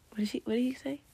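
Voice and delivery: ordinary delivery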